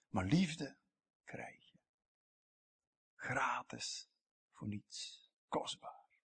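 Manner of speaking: hushed whisper of wonder. Acted. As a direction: drop to a breathy whisper for the key truth. Whispered climax of sermon.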